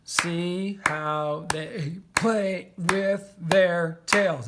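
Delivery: very slow, stretching each syllable; drawn-out dictation while writing; syllable-counting. Shown as a demonstration. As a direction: count the seven beats aloud. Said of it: "That's seven".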